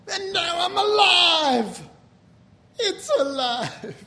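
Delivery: drawn-out, high mock cry of life; exultant cry. Preacher mimicking Frankenstein to the congregation, hammed-up cry. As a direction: cry it out big and slow, hammed-up.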